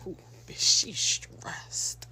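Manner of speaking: whispered aside. Real, not acted. Whispering to mom about the stressed hairdresser. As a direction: breathe it under your breath. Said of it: "unvoiced whisper to mother".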